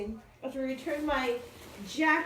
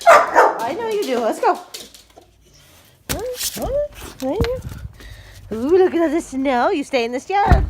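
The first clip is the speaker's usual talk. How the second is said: excited calling to dogs; 4 semitones higher, 9 dB louder than usual. Real, not acted.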